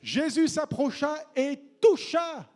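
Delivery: solemn slow climax; dramatic reading. Acted. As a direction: read it with dramatic weight. Verse re-read dramatically.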